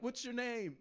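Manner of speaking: high, loud call across the street. Retold or imitated. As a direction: yell after someone who is leaving. Calling after man running away.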